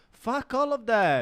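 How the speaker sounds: loud, slow, fed-up snap; cutting off his own list, fed up and loud; exasperated outburst. Real, not acted. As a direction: throw the whole list away, loud. Frustrated cutoff of labels list.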